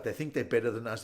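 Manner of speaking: ordinary talk, nothing marked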